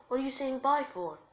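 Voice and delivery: high, loud, puzzled question; surprised. Acted. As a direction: ask it in startled protest. Naruto startled by the goodbye.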